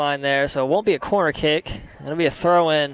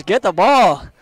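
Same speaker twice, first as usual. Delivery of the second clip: louder, higher quoted shout; imitated coach's yell; 4 semitones higher, 6 dB louder than usual. Commentator quoting the head coach yelling at his players, raised imitation yell. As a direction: mimic a coach yelling an order, without full force. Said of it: "Sumter head coach yelling at his players".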